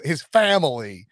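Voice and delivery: slow, drawn-out Southern FAMILY; mock Southern accent. Host asking about coach talking at home, mock Southern accent. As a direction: stretch the word out in a fake Southern twang. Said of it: "in that accent".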